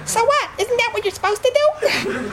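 very high-pitched, mock girl voice; imitated puzzled girls. Girls reacting to the rabbi's story, in a high mock voice. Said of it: "imagine telling that story to girls".

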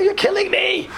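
high, strained comic plea; mock agony. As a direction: protest in a high voice as if being tortured. Pupil protests during painful exercises.

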